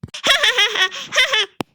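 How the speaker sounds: high, loud silly cackle; hammed-up doll laugh. Doll laughs wildly at her own joke. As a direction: laugh too long at a bad joke.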